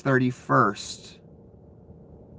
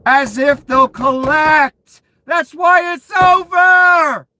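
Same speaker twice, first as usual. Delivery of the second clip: higher, louder, slow mocking yell; slow shout; 12 semitones higher, 6 dB louder, slower than usual. Real, not acted. Streamer yelling slowly at doomed gamblers. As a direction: drag it out at full volume. Ranting at bettors.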